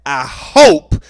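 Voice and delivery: "I hope" with a loud, punched "hope"; stress on hope. Shown as a demonstration. Streamer copies the judge's emphasis for chat, punched stress. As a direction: lean hard on "hope" like the judge did. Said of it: "See how she emphasized that?".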